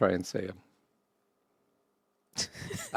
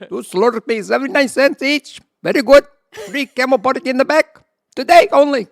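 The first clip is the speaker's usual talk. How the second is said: high, loud shopkeeper Indian accent; hammed-up Indian convenience store clerk; 13 dB louder than usual. Shown as a demonstration. Showing off the Indian accent to friends, loud and high. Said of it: "Indian, Indian one".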